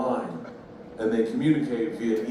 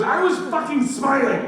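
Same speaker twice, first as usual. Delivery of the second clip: loud, high-pitched mock outrage; indignant yell; 12 semitones higher, 5 dB louder than usual. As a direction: yell it back as the offended customer. Acts out woman snapping back.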